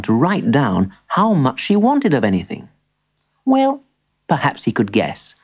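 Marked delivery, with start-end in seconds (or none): none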